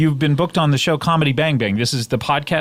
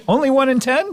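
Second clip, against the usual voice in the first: high incredulous squeak; mock surprise; 9 semitones higher than usual. Host's mock disbelief.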